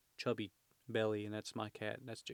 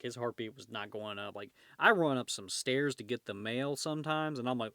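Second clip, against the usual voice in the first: ordinary delivery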